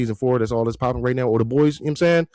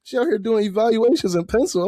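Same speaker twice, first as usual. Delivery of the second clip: amused disbelief; 11 semitones higher, 4 dB louder than usual. Retold or imitated. Mocking the therapist, amused.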